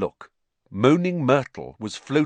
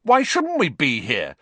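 loud, raised indignant comeback; heated defiance; hot retort; 6 semitones higher, 6 dB louder than usual. A narrator's reading. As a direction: snap back at a bossy brother. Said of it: "said Ron hotly".

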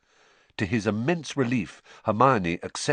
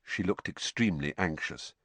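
ordinary delivery, nothing marked

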